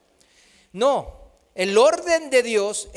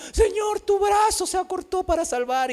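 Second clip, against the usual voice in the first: crying out to God; 10 semitones higher than usual. Retold or imitated. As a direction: call out to the Lord in anguish. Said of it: "clamándole al Señor".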